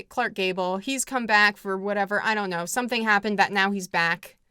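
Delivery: ordinary talk, nothing marked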